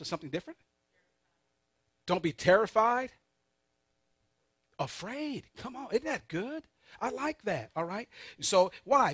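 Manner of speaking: usual speaking voice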